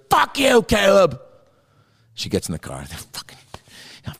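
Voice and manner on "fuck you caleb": angry yell. Retold or imitated. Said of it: "She goes".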